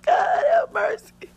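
high sobbing cry; real grief breaking through. Real, not acted. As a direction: wail it out to God. Reliving her cry of shock.

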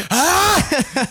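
very high, loud mock sneeze yell; huge dad sneeze. Son imitating his dad's sneeze, huge battle-cry sneeze. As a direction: imitate a sneeze everyone has to hear, like an attack cry. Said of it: "When my dad sneezes".

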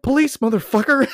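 high, loud belated announcement; mock police shout. Cousin announcing himself to muggers, comic shout.

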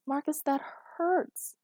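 high, drawn-out cry of protest; slow, high-pitched complaint; pained protest. A narrator's reading. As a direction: cry out that it hurts. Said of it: "She cried out and tried".

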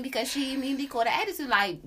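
ordinary talk

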